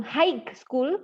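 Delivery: "high" said "haik" with a hard end; wrong pronunciation. Shown as a demonstration. Teacher demonstrating a variant some people say, wrong pronunciation. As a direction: add a k sound to "high". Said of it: "ada yang bilang high school".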